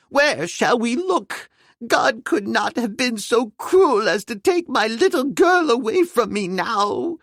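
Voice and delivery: high, slow voice full of despair; high pleading anguish; anguished father. A narrator's reading. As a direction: plead in fear for his lost girl. Father fears for his daughter.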